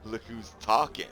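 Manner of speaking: teasing comeback. Acted. He teases Nora about her own goofy smile, loud and playful. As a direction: toss it back at her, grinning.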